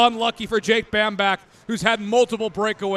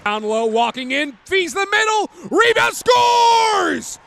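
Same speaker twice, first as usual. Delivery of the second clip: shouted goal call; rapid hockey play-by-play; 3 semitones higher than usual. Real, not acted. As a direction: build to a roaring goal call.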